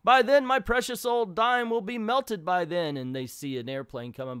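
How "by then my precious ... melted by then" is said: frantic. Retold or imitated. Scrooge panicking.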